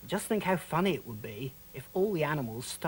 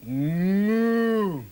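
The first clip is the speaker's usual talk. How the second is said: cow mooing; 3 semitones higher, 8 dB louder than usual. Pretend. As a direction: draw out a big cow's moo. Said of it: "And cows".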